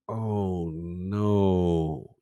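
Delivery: low, soft, drawn-out mock lament; retold dismay. Gamer reacting to the new character's look, dismayed. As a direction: groan it out slowly, quietly deflated.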